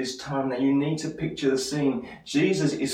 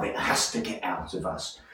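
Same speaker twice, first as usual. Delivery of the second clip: hushed; 9 semitones lower, 7 dB quieter than usual. Real, not acted. Preacher drops to intense hush.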